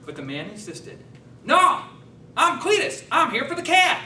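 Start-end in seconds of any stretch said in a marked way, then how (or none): 1.4-4.0 Cletus the country man. Acted. Farm visitor at the door, high loud yokel voice. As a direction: pitch way up and twang it out as the farm visitor. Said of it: "wearing bib overalls and a John Deere tractor hat".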